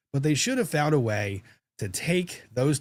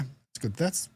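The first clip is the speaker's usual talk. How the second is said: usual speaking voice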